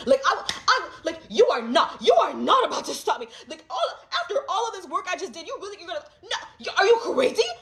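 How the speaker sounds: loud, high, laughing outrage; laughing high outburst at the family; defiant laughing shout. Retold or imitated. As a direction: shout that they can't stop you, laughing in disbelief. Defiant rant at relative.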